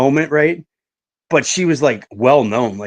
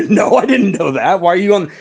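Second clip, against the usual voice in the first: higher, louder, laughing through the retort; laughing disbelief; 7 semitones higher, 3 dB louder than usual. Retold or imitated. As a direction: laugh in shock as you answer her. Retelling a shocking reply.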